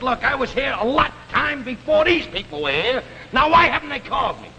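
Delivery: high-pitched, indignant retelling; worked-up customer demanding service; indignant complaint. Retold or imitated. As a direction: act out complaining to the cashier. Retells his complaint to cashier.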